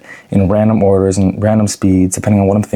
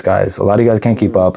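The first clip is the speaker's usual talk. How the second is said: normal talking voice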